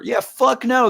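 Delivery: ordinary delivery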